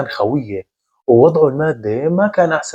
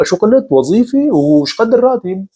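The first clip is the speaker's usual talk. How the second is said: sudden high, loud disbelief; stunned surprise; 8 semitones higher, 3 dB louder than usual. A narrator's reading. Friend just mentioned a job, stunned shout. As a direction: blurt it out, caught off guard. Choking on tea at job news.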